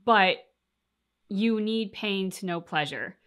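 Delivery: ordinary talk, nothing marked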